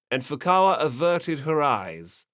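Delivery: usual speaking voice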